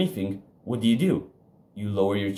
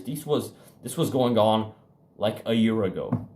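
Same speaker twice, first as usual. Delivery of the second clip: normal talking voice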